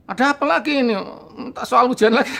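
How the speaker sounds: fed up, what now; exasperated. Retold or imitated. Annoyed narrator answers friends knocking at night, high exasperated voice.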